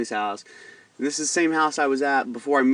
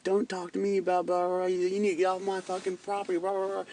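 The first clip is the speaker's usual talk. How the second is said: higher, softer sneering stepdad impression; mocking stepdad; 7 semitones higher, 5 dB quieter than usual. Retelling the stepdad ordering him off the property, high and mocking. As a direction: brush it off like a smug stepdad. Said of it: "And he's like".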